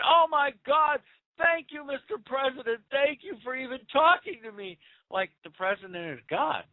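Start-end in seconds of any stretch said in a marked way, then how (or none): 0.1-4.8 mocking gushing fan. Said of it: "a man who stood up at a university and said".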